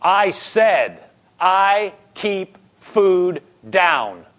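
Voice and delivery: drawn-out, defiant insistence; insistent declaration. Retold or imitated. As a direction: hammer out each word slowly and separately. Insistent confession after throwing up.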